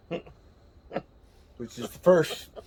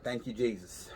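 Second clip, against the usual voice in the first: muttered relief; 5 semitones lower, 12 dB quieter than usual. Retold or imitated. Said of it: "I was like".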